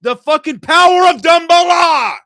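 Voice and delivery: roaring the name Dumbledore like a battle cry; full shout. Real, not acted. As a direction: blast it out in disbelief. Mock outrage at critics.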